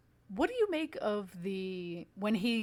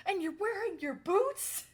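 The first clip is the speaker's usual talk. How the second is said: incredulous shriek; 12 semitones higher, 4 dB louder than usual. Retold or imitated. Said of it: "And then she's like".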